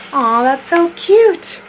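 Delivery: high, loud, slow aww; cooing. Real, not acted. Admiring puppy slippers, she coos.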